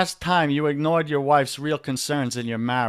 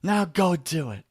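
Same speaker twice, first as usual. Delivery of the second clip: preacher quote; 6 semitones higher than usual. Retold or imitated. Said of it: "We just hear from the pulpit every Sunday".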